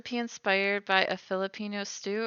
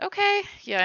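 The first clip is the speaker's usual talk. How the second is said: surprised; 11 semitones higher, 7 dB louder than usual. Real, not acted. Sudden realization reading chat.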